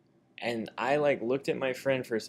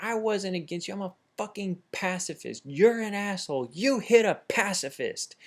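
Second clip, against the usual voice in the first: high, loud, righteous protest; indignant retold yell; 10 semitones higher, 5 dB louder than usual. Punched man yelling back at his attacker, high indignation.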